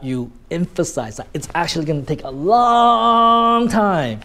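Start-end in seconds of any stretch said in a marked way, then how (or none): 1.3-4.2 long stretched out at great length; elongated long. Shown as a demonstration. Teacher showing word emphasis, long hugely prolonged. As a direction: hold long on and on before "time". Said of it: "you actually elongate that word".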